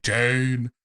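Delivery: low, slow, commanding vampire. Acted. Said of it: "That was good vampire".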